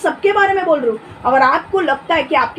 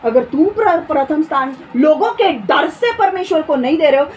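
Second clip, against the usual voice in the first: normal talking voice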